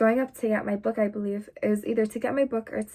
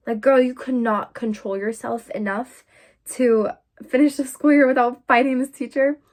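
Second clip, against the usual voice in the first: mock self-scolding; 3 semitones higher, 9 dB louder than usual. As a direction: scold your younger self, half joking. Scolding her younger self.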